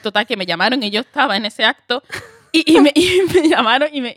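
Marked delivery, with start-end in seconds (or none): 2.5-4.1 loud, high, laughing through the words; laughing excitement. Real, not acted. Actress tells her friend about the job call, loud and laughing. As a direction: let the joy spill into a laugh.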